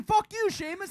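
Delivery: high, loud jeering taunt; taunting yell. Retold or imitated. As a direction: jeer at him like a heckler. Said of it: "That's what somebody said in the group chat".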